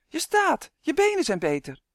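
high, loud joyful amazement; shouting. A narrator's reading. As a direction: cry out the wonderful news. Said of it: "schreeuwde Uf". (blij verbaasd uitroepen)

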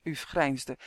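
usual speaking voice

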